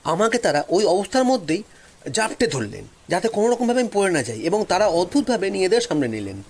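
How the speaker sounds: plain reading voice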